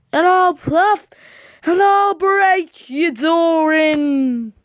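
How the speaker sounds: high, slow spooky menace; slow threatening ghost. Acted. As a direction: moan the threat like a wolf at the door.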